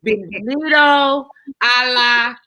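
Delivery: slow Spanish phrasing; Spanish welcome said slowly. Shown as a demonstration. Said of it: "I said it".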